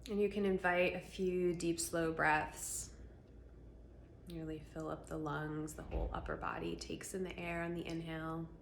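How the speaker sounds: calm voice leading a breathing practice; guided meditation. Acted. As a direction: ease them gently into their body.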